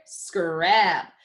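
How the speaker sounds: "Scrap" joined up, still slightly stretched; blended word. Shown as a demonstration. Teacher putting it all together, blended. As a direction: blend the sounds into one "Scrap". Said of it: "Put it all together".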